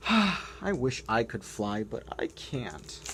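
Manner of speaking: plain reading voice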